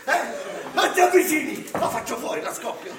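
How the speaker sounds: loud, hammed-up warning; mock hostage threat. Santa warning the kids off, loud mock menace. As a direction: yell it like a cornered villain.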